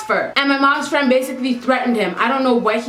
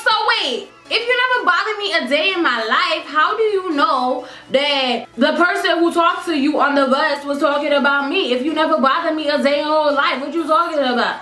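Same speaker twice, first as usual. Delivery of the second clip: heated confrontation; 3 semitones higher than usual. Retold or imitated.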